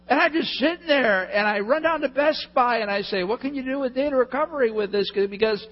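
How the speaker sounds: high, loud, desperate plea; frantic retelling. Retold or imitated. Preacher retelling his run to Best Buy, frantic and loud.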